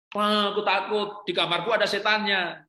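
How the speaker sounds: naikkan suara seperti anak kecil yang takut, agak lebih keras; suara anak ketakutan. Retold or imitated. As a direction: anak kecil merengek takut pada ayahnya. Ayah menirukan anak menangis malam. (anak merengek ketakutan di malam hari)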